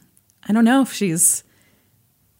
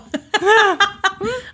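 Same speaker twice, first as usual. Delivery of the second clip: high laugh; 11 semitones higher than usual. Real, not acted. Laughing after heavy story.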